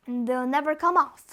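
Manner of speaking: ordinary delivery, nothing marked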